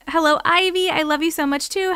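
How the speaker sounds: usual speaking voice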